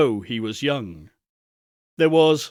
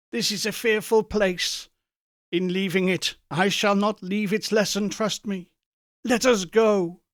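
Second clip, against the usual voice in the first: put-on higher, pleading old man's voice; frightened Scrooge; 7 semitones higher than usual. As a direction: beg to leave this place.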